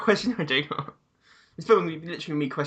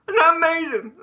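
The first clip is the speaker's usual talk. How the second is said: excited; 8 dB louder than usual. Real, not acted. Excited reaction to movie.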